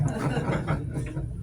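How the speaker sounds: short breathy laugh; a quick silent chuckle. Real, not acted. Laughing at own punchline.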